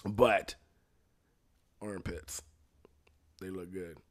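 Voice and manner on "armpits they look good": hushed single word, breathed out; whispered. Real, not acted. Telling friends about noticing armpits, whispered confession. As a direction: let it slip in a whisper.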